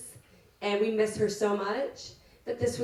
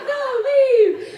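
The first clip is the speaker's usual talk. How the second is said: high, loud mock shout of a mom; mock yell; 14 semitones higher, 11 dB louder than usual. Bride imitating her mom chasing the husband off. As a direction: shoo him away, hammed-up. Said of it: "then she'd be like".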